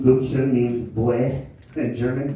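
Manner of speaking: ordinary talk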